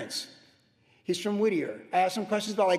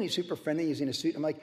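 ordinary talk, nothing marked

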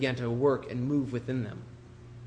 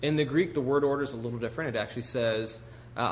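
normal talking voice

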